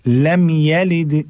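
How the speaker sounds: लम यलिद, no hum on the M; short ya. Shown as a demonstration. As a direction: recite यलिद without lengthening its A. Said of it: "या भी नहीं करना है".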